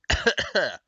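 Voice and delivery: hard cough after a gulp; choking cough. Real, not acted. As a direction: cough out the swallowed water. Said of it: "too enthusiastic of a gulp".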